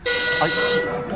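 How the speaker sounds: high squeak. Acted.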